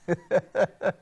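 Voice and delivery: chuckling laugh at his own story; real, amused chuckle. Real, not acted. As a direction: break into laughter. Amused by his own retold dialogue.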